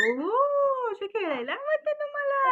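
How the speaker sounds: very high, swooping coo. Real, not acted. Parent cooing back at the baby. (बाळाशी लाडात, खूप उंच आवाजात)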